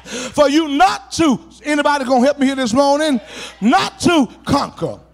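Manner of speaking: loud, driving sermon cadence; fervent pulpit shout; preaching at full force. Acted. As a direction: preach it hard and rising to the congregation. Climax of the sermon.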